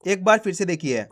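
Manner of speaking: ordinary talk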